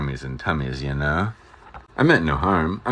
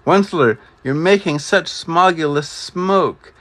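high, loud, croaky scolding; the Lorax scolds the Onceler in a high croaking cry; 11 semitones higher, 3 dB louder than usual. A narrator's reading. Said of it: "he cried with a cruffulous croak".

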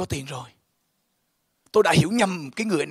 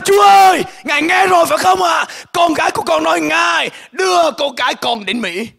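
high, loud joyful cry; shouting to God; 12 semitones higher, 11 dB louder than usual. Retold or imitated. Father crying out to God after daughter's answer, loud and high. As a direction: shout it out, overwhelmed with praise. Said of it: "Tôi la lên".

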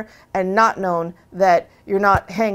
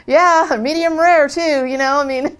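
playful; 6 semitones higher, 6 dB louder than usual. Retold or imitated. Joking reply to stranger.

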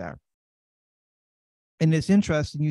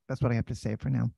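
ordinary delivery

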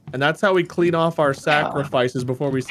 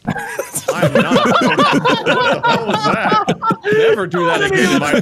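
high, squealing laughing protest; words squeezed out through laughter; helpless laughter; 4 dB louder, slower than usual. Real, not acted. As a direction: protest while cracking up. Cracking up at strange noise, scolding.

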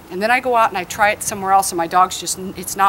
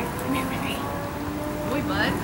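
higher and much softer cooing; cooing to the dog; 5 semitones higher, 9 dB quieter, slower than usual. Real, not acted. Trainer softly coaxing her dog close, soft cooing. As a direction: coax him in gently and sweetly.

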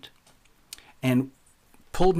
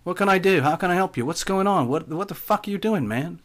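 put-on raised, urgent English accent; higher-pitched English accent, anxious to help; 6 semitones higher than usual. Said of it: "said in an English accent, something like this".

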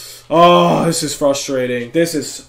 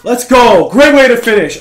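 triumphant shout; 6 semitones higher, 10 dB louder, slower than usual. Real, not acted. Game-winning shot celebration.

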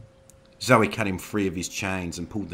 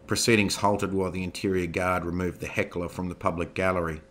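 plain reading voice

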